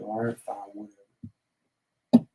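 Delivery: soft, slow, drawn-out whatever; dismissive. Retold or imitated. Answering his girlfriend's marriage offer, soft dismissive whatever. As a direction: shrug it off, barely caring.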